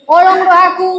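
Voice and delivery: forceful Batak imitation; Batak, angry-sounding. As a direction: say it in Batak as if scolding. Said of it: "bisa kayak marah-marah gitu loh".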